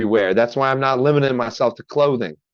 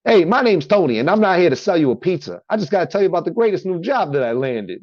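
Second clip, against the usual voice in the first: high, loud-opening Italian-American pitchman; Italian accent; 7 semitones higher, 3 dB louder than usual. Shown as a demonstration. Tony pitching his new job on radio, Italian accent. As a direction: do a hammy Sopranos-style Italian accent. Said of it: "I just do this Italian accent".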